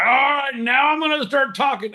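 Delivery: very high mock voice. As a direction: squeak it out to mock him.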